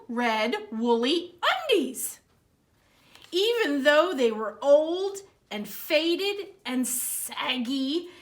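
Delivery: lively storybook read-aloud; reading to children. Acted. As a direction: read it like story time for kids. Reads picture book aloud.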